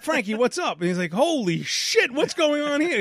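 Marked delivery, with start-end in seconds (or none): 0.0-0.8 cheery greeting. Retold or imitated. 1.1-3.0 high, loud alarmed exclamation; shocked coworker. Retold or imitated. Coworker seeing the blood.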